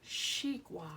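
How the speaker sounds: xigua said as shee-gwa in Mandarin; Chinese xigua. Shown as a demonstration. Teacher names watermelon in Chinese for pupils, shee-gwa. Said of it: "it really starts with an X".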